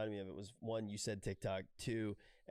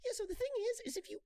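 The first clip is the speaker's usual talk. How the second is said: much higher, fast, louder hammed-up hype-guru voice; mocking a TikTok impersonator, high fast hype voice; Gary Vee impersonation; 4 dB louder, much faster than usual. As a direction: rattle it off like an overexcited motivational guru. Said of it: "people doing Gary Vee impersonations".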